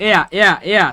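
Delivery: "এয়া" repeated with silent h; pronunciation demo. Shown as a demonstration. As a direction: drill "এয়া" starting on the vowel, no h. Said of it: "এটার উচ্চারণ হচ্ছে". ("এয়া" হ ছাড়া, বারবার)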